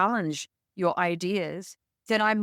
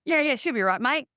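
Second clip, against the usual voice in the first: mock laid-back Australian accent, pitched up; 7 semitones higher, 3 dB louder than usual. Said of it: "in true Australian fashion".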